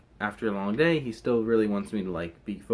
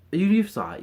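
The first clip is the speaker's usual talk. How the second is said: loud teasing; 10 semitones higher, 7 dB louder than usual. Acted. Teasing the viewer, repeated.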